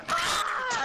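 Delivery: loud, high "ah" shout; sudden acted-out holler; re-enacted yell. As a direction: yell as you did when startled. Re-enacting his startled yell.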